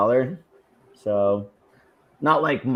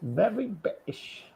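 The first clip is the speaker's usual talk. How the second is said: put-on British accent; 5 semitones higher, 9 dB quieter than usual. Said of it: "Rogue is definitely very British".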